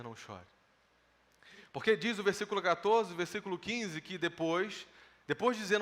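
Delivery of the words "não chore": much softer, lower and gentle; Jesus gently consoling the grieving mother, softly; tender hush. Acted. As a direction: say it as a soft, comforting promise. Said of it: "Jesus podia dizer".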